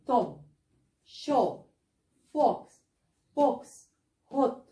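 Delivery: each word with an open o sound. Shown as a demonstration. Teacher reading a word list for students, open o. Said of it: "زي".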